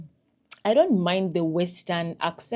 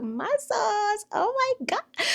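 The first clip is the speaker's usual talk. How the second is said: high-pitched gushing squeal; mock swooning; slower than usual. Gushing to viewers over a handsome guy's muscles, swooning. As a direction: gush like a thrilled admirer.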